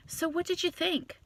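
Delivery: high, soft mock-expectant question; imitated date asking. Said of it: "he looks at me and he goes".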